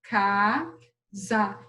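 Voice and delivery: "Casa" as two long, separated syllables. Shown as a demonstration. Teacher counting with pupils, "Casa" split in two. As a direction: stretch "Casa" into caa... za, pausing between. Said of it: "Vê comigo?".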